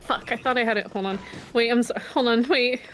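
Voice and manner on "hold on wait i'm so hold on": ordinary talk, nothing marked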